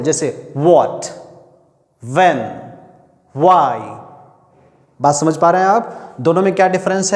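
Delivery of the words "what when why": what, when, why with rounded lips, drawn out slowly; teacher stretching each W word. Shown as a demonstration. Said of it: "दोनों को round करना पड़ता है, गोल".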